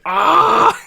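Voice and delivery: sudden high loud scream; mock scream. Imitating the healer screaming at a raid flashback, loud shriek. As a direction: shriek as if the flashback just hit. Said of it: "She's like".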